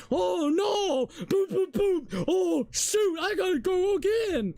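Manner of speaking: imitating his own panic in the game; mock panic. Acting out failing the minigame.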